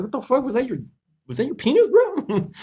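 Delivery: high, startled yelp of disbelief; high-pitched shocked disbelief; shocked outburst. Retold or imitated. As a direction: squeak it out in stunned horror. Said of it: "And I'm just like".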